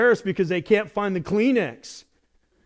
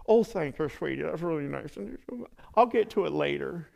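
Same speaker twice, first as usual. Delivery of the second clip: soft, restrained polite thanks; quiet mild grandma voice; polite grandparent; 6 dB quieter than usual. Retold or imitated. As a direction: play a reserved grandparent, unexcited. Mocking reserved grandparents.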